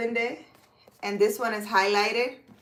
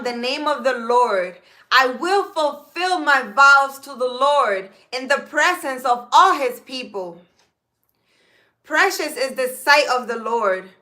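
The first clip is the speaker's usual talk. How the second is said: psalm reading; 2 semitones higher, 4 dB louder than usual. Acted. Reads psalm aloud.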